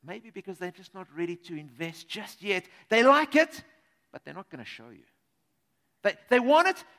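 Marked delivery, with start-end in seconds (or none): 2.9-3.6 emphatic. Acted. Contrasting hidden feelings. 4.1-5.0 much lower and softer; hushed aside. Acted. Contrast, hushed.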